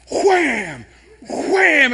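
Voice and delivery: loud, rising mock hammer blows; sledgehammer wham. Smashing rotors off, loud playful wham sounds. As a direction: belt out each wham like a swing. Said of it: "I'm like".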